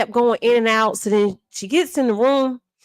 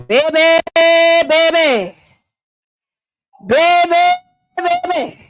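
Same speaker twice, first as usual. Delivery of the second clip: alarmed calls; 3 semitones higher, 5 dB louder, slower than usual. Retold or imitated. Calling out in alarm.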